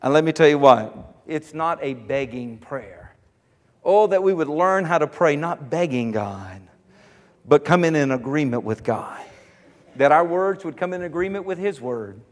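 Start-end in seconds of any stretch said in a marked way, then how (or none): 1.3-3.2 soft emphasis. Real, not acted. Preacher drops voice for emphasis. 5.3-9.2 soft emphasis. Real, not acted. Preacher quiet emphasis.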